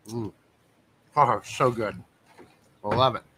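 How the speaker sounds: talking through a mouthful of pretzels; crunching between words; eating while talking. Real, not acted. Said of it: "Sorry if you hear me crunching".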